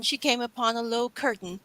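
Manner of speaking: ordinary delivery, nothing marked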